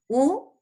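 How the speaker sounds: "U" alone, loud, high and rising; isolated syllable. Shown as a demonstration. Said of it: "es una sílaba". (la sílaba "U" aislada, fuerte y aguda)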